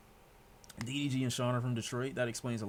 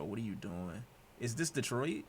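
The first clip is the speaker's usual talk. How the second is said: ordinary talk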